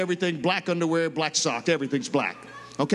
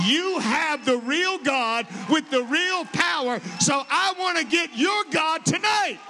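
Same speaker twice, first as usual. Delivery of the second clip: pleading shout; 9 semitones higher than usual. Retold or imitated. Gene at the altar begging the preacher, shouting. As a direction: cry out for the real God. Gene's conversion cry retold.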